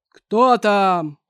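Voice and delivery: loud booming giant. Acted. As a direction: boom it out like a giant. Giant asks who's there.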